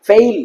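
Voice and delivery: long "ফেইল" gliding from e to i; fail diphthong. Shown as a demonstration. Teacher setting fail apart from fell, gliding-vowel "ফেইল". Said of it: "ফেইল আলাদা".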